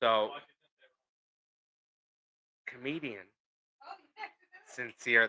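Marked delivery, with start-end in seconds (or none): 2.6-5.3 halting, one word at a time, long pause between; slow and careful. Real, not acted. Podcast host reminding himself of his guest's name, slow and careful. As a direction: say the name slowly, softly, as if remembering it. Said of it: "I had to say it slow to remind myself".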